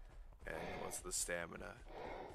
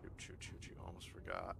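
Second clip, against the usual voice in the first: hurried whispered shoot, shoot; sudden whisper after nearly forgetting; 12 semitones lower, 6 dB quieter than usual. Real, not acted. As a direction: whisper it under your breath, caught out. Hurried whisper, forgot something.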